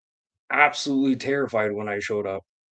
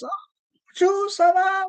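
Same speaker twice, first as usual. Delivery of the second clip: high, put-on French accent; mock French greeting. Comic mocking his nice entrance, high put-on French accent. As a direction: sing out a fake French hello.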